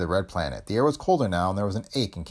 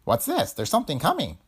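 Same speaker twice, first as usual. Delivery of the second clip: high-pitched alarmed question and warning; shivering alarm; 7 semitones higher than usual. A narrator's reading. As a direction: sound the alarm as a small frightened creature. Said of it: "Bill hit his leg and shivered".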